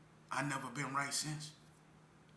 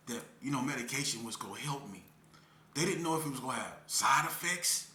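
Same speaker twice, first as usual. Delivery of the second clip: ordinary talk, nothing marked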